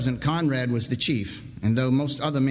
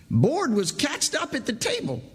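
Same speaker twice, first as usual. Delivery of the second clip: shaken saw filer; 12 semitones higher than usual. Acted.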